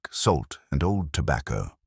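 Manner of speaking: ordinary delivery, nothing marked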